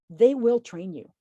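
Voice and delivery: usual speaking voice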